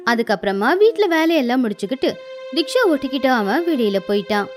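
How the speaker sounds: plain reading voice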